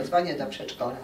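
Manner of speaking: ordinary delivery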